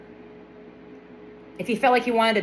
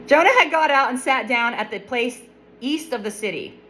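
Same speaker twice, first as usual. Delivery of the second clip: normal talking voice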